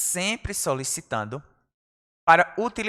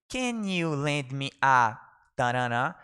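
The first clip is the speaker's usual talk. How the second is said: slow model phrase; 3 semitones lower, slower than usual. Shown as a demonstration. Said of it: "teacher models phrase slowly".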